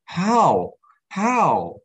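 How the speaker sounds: high, pained demand of God; angry plea. Retold or imitated. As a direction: press God for an answer, hurt. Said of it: "I'm angry as well".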